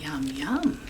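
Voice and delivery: hushed, breathy whisper of greed; whispered yum. A narrator's reading. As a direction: whisper it like savouring a treat. Giant drooling over eating children.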